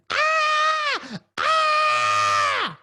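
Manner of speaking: put-on a girl's screams of pure fear; terrified scream. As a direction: shriek over and over in terror. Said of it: "She's screaming in fear, in terror".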